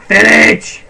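loud thrilled exclamation; excited shout. Real, not acted. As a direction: call it out overjoyed. Spots a village.

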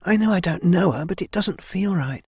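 high-pitched, uneasy protest; arguing heroine. A narrator's reading. Kai argues over killing an unknown sister, protesting. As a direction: object earnestly in a lifted young voice. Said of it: "Kai argued".

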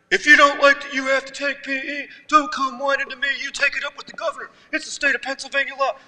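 lightly voiced stern gym coach, rattling off rules; gym coach yelling. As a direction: imitate a no-nonsense coach laying down the law. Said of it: "He's saying things like".

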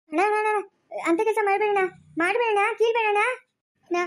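high, urgent begging; desperate pleading. Acted. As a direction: beg your brother to stop. Begs brother not to tear off thali.

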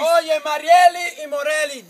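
slow, loud called-out greeting; shout-out. Real, not acted. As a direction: call their names out loud. Shout-out to the girls. (saludo gritado y lento)

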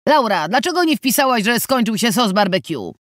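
irritated boss shouting the question, pitched high; angry yell. A narrator's reading. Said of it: "wkurzony krzyk".

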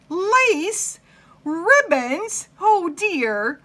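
high, loud, incredulous questions; aghast storybook mistress; appalled disbelief. Acted. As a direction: gasp out each word in mounting dismay. Mistress discovers decorated steak in icebox.

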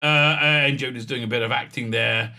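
ordinary talk, nothing marked

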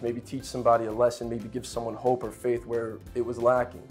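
normal talking voice

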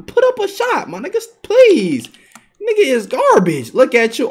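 high, slowed whiny begging; mock pleading taunt. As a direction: beg in a high mock whine, dripping with scorn.

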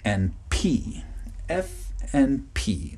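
slowly spelled out letters; teacher saying the letters slowly for learners; slow and clear. Shown as a demonstration. Pronunciation teacher slowly naming the letters. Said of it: "the difference between the letters".

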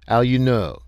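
put-on quick, eager French-accented snap; a light French accent, keen and curt; snapped question. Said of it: "snapped Leroy".

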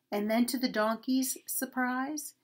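ordinary delivery, nothing marked

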